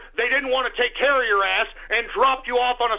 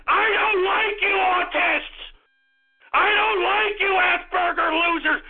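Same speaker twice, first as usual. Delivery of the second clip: furious shouting; 2 semitones higher than usual. Real, not acted. Host ordering listeners off his broadcast, furious shouting.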